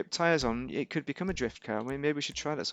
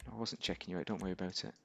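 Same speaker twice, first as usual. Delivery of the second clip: quiet joking aside; 8 semitones lower, 11 dB quieter, faster than usual. Pretend. Joking aside to an imagined viewer.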